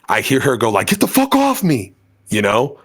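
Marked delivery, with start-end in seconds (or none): 0.9-1.9 a hint of a panicked stranger yelling; mock yell. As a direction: shove the words out in a high mock shout. Retold guy yelling at crowd.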